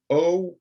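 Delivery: high loud oh; isolated vowel. Shown as a demonstration. Teacher isolates the oh vowel. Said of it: "the second one".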